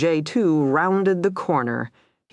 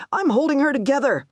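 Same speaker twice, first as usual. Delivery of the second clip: hoarse and higher, rushed out fast; hoarse, fast, pitched up with shock; 11 semitones higher, faster than usual. A narrator's reading. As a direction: hold on to her, voice raw and hurried. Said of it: "he said hoarsely".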